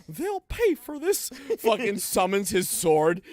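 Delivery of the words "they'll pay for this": high, slow cartoon vow; cartoon character quote. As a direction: squeak out a hammed-up revenge line. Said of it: "the cutscene in Kingdom Hearts two".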